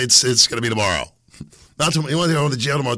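ordinary delivery

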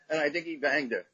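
usual speaking voice

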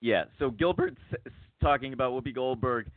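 ordinary delivery

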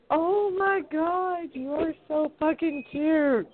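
very high, slow gushing coo. Retold or imitated. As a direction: melt over how cute it is. Gushing over cuteness.

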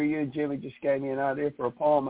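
ordinary talk

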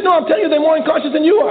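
high-pitched mock old man, insistent; imitating elderly man. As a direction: squeak out the old man's complaint.

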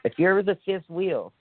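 usual speaking voice

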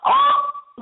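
high, loud "oh!"; startled, delighted high yelp; sudden excited cry. Real, not acted. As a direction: squeal it as if suddenly remembering. Exclaims at remembering listener mail.

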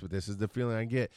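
ordinary talk

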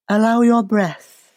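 usual speaking voice

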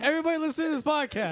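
loud, fast, high proclamation; loud announcing. Acted. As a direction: call it out fast to all listeners. Repeats it, shouted at listeners.

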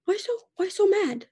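put-on high, childlike voice asking why mom is mad; imitating her daughter. Mother imitating toddler asking why she is mad, high childlike. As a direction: play the little girl, puzzled and innocent. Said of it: "she would, like, look at me and be like".

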